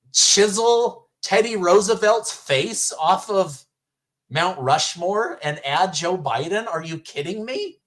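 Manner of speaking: incredulous. Real, not acted. Incredulous at Pelosi's claim.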